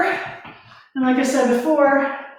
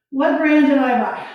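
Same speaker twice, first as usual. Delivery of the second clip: ordinary delivery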